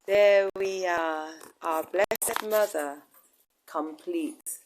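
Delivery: loud, slow proud announcement; excited reveal. Real, not acted. Unveiling the completed statue.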